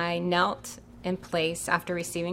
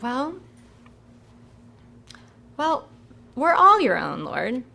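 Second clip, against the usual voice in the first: nervous rationalizing; 5 semitones higher, 5 dB louder than usual. Retold or imitated. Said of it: "I'm like".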